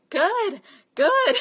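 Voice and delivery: loud, high-pitched "good"; gleeful approval. Retold or imitated. Coach answering a client who says her legs hurt, gleeful. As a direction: burst out with delighted, pushy approval.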